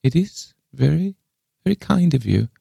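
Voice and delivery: high, faltering thanks; pathetic gratitude. A narrator's reading. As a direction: thank her pitifully in a high old voice. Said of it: "with a pathetic gratitude".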